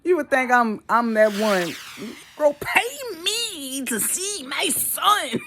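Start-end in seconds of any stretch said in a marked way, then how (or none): 2.4-5.4 high, slow mock-outraged man; mock dad outrage. Mimicking the father who had to pay, high mock outrage. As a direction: squeak it like a man who can't believe it. Mocking the dad's complaint.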